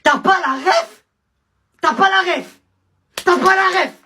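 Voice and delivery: shrieking high, loud repeated question; hammed-up fury at his ignorance; screeching comic indignation; mock outrage. Escalating outrage over reference.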